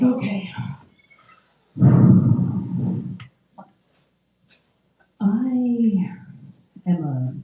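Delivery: low, slow, sultry put-on purr; hammed-up seductive voice, low and slow; mock dirty talk. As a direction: practice sounding filthy, hammed up. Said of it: "practice by talking dirty to yourself".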